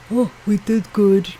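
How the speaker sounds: a lightly put-on New York accent, mocking; lazy New York accent. Vlogger mocking the New York governor's speech, in a New York accent. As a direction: mimic a droning New York politician. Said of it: "I do a much better New York accent".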